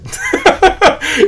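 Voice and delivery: a sudden very high laugh at his own joke; bright burst of self-amused laughter; real laugh. Real, not acted. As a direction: crack up at yourself. Laughs at his chaotic head.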